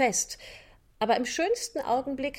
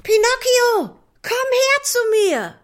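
much higher, louder cheering calls; joyful chorus shouts; 14 semitones higher, 10 dB louder than usual. Acted. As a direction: puppets cheering their brother. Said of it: "rufen nun alle Marionetten im Chor".

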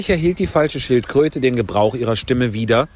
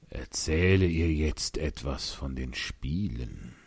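low, slow, quiet Gryphon voice; bestimmter Greif; 9 semitones lower, 9 dB quieter, slower than usual. Acted. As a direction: cut in firmly, deep and slow. (tiefe, langsame Greifenstimme, bestimmt)

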